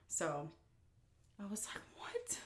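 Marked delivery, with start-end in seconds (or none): 1.4-2.4 whispered. Retold or imitated. Stunned reaction, whispered.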